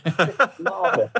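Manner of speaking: posh little boarder greeting his Cockney dad; posh boarding-school accent. Retold or imitated. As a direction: put on a hammed-up posh English accent, amused. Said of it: "sends me off to boarding school".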